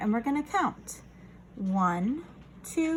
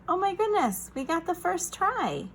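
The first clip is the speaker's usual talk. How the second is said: excited; 8 semitones higher than usual. Acted. Teacher excited at first try.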